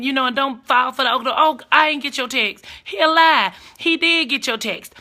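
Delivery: usual speaking voice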